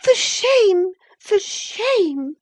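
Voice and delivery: very high, indignant outcry; high-pitched angry scolding. Acted. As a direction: cry it out in outraged reproach. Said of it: "she repeated angrily".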